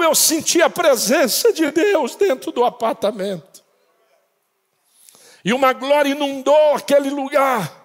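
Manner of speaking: preacher lifting the crowd; fervent preaching. Acted. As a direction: build the testimony into a shout. Testimony rising to preaching.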